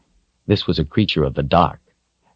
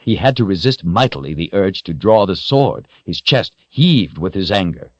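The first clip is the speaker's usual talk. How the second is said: ordinary delivery, nothing marked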